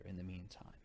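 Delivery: ordinary delivery, nothing marked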